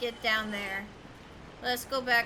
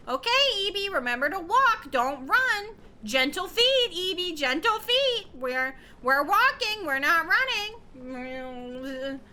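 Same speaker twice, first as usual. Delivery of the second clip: higher, louder bossy hall monitor; safety patrol kid; 5 semitones higher, 5 dB louder, slower than usual. Retold or imitated. Streamer mimicking a school safety patrol telling Evie to walk. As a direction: scold like a school safety patrol, slowing at the end. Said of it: "someone just being like".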